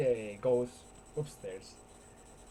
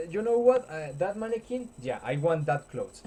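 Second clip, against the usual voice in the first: higher, louder eager pick; excited demand; 6 semitones higher, 9 dB louder than usual. Retold or imitated. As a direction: point at it eagerly. Old man picks mannequin outfit.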